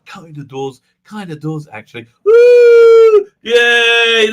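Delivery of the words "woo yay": cheer. Real, not acted.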